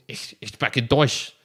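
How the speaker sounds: loud, harsh show-off German. Shown as a demonstration. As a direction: lean hard into the harshness of German, ending in a laugh. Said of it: "That, that kind of harshness to it".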